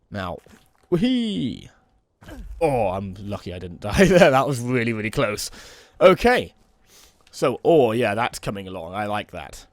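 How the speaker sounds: normal talking voice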